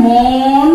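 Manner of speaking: মন with its vowel held very long, as "mooon"; drawn-out vowel demo. Shown as a demonstration. Teacher pointing out the vowel to students: মন drawn out slowly. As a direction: stretch the rounded o in মন slowly. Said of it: "এটাকে কিন্তু আমরা উচ্চারণ করব".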